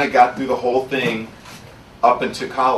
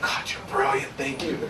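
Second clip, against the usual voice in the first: awed hush; 4 semitones higher, 5 dB quieter than usual. Retold or imitated. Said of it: "And I went".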